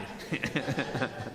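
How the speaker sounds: quiet chuckle trailing off; a low amused laugh; soft laugh. Real, not acted. Laughs after punchline.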